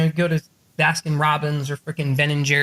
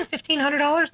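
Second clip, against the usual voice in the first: imitating clients, pitched way up; high-pitched echo; 9 semitones higher than usual. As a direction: echo the sum as a high question. Repeated as clients' reaction.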